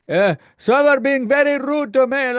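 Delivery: high, put-on complaining customer voice; hammed-up whiny complaint; impatient customer. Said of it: "the guy gets really impatient".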